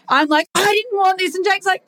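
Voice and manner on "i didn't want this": high-pitched weepy whine; mock crying. Protesting the new house to Jake, mock crying. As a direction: act out a tearful protest, pitched up. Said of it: "And I'm crying".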